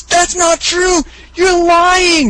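high hammed-up denial; mock denial. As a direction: wail in disbelief at the spoiler. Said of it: "He goes".

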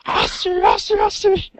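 very high, loud mock chant; echoing battle cry. Co-host imagines it echoing through the pit, high mock war chant. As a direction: call it out to ring across an arena. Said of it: "it's echoing throughout the pit".

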